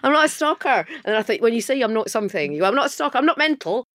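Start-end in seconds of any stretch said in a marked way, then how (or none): 0.0-0.8 high, loud retold panic with laughter; mock indignant denial; mortified protest. Re-enacts embarrassment at being recognised. 2.7-3.8 mock protest. Repeats her embarrassed denial.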